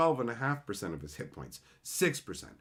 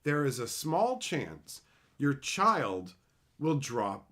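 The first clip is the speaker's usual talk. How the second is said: ordinary talk, nothing marked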